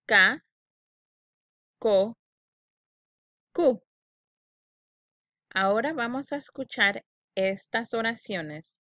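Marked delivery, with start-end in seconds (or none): 0.1-3.8 louder "Ca, co, cu", each syllable alone; choral syllables. Shown as a demonstration. Teacher reading syllables aloud with the class. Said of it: "Vamos a repetirlo".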